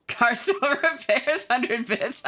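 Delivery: high-pitched, slowed by laughter; words breaking into giggles; laughing. Real, not acted. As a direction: crack up while reading it out. Breaks up reading a message.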